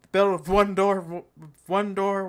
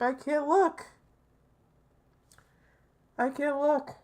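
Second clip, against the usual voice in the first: higher, put-on despairing voice; mock dramatic; 6 semitones higher than usual. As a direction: turn away in hammed-up despair. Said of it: "I'm not being over dramatic".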